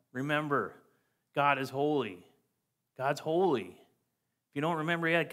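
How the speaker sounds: ordinary talk, nothing marked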